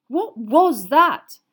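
stunned by the attack; shocked. Acted.

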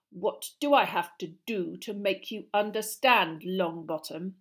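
slow, withering schoolmaster; scornful Snape. Acted. Snape berates Longbottom over his potion, slow and scornful. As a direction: berate a pupil slowly, word by word.

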